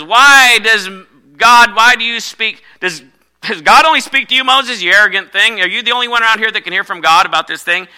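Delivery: high, loud, affronted challenge; jealous sister scolding Moses, loud and high; ticked-off Miriam. Retold or imitated. Miriam confronts Moses, jealous and angry. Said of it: "Miriam got all ticked off and says".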